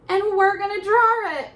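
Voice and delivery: high, loud, thrilled voice; excited. Acted. Teacher thrilled to tell kids they'll draw a snowman. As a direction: sell the fun to the kids.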